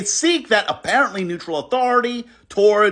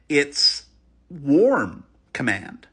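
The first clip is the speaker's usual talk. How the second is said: ordinary talk, nothing marked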